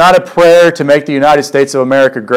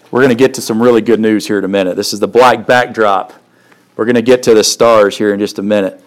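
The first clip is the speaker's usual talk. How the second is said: quick aside; 4 semitones lower, faster than usual. Real, not acted. Quick aside before reading.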